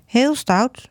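put-on high, small child's voice repeating "Heel stout"; little girl echoing mum. A narrator's reading. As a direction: parrot mum's words like a toddler. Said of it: "zegt Lotte". (klein meisje, hoog, herhaalt "Heel stout")

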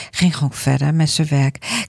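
ordinary delivery

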